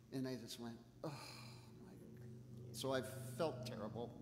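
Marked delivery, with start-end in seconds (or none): 1.0-1.9 breathy, barely-there gasp of dismay; mortified whisper. Retold or imitated. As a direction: whisper it as if caught out. Mortified on meeting dog's owner.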